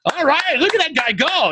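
high, hyped-up imitation of impressed fans; cheering crowd. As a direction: call it out like a thrilled audience member. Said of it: "people are already like".

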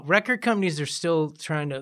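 ordinary talk

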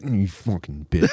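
a hint of a nasty old husband hissing aside; mock aside to wife. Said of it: "abuse your elderly wife".

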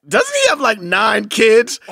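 very high, loud start, then drawn out slowly; mock disbelief. Friend relaying what she said, high mock disbelief. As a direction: imitate her incredulous putdown, stretching the end. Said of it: "she was like".

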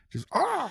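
sudden high yelp; sharp yelp. Pretend. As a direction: let out a sharp cry. Stabbing Aaron Rodgers gag.

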